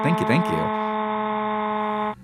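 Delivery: high, slow, stretched "Thank you, thank you."; a playful high crooning thanks; sing-song thanks. Pretend. Drawn out thanks to subscriber.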